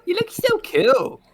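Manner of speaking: high, slow, gushing fan voice; high fawning admirer voice; mock fawning admirer. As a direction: gush over him like a starstruck kid. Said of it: "He's just gonna be like".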